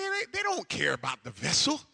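usual speaking voice

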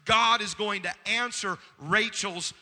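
loud, high preaching with weighty stresses; forceful preaching. Real, not acted. Preacher driving a point home to the congregation, forceful and loud. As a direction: preach it out at full voice, building in pitch.

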